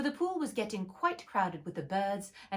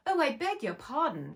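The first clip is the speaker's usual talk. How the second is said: hasty apology; 7 semitones higher, 4 dB louder than usual. Acted. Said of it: "cried Alice hastily".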